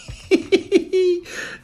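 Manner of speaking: thin, high-pitched laugh; high giggle. Real, not acted. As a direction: giggle high at your own joke. Laughs at own biker joke.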